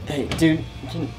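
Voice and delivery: ordinary delivery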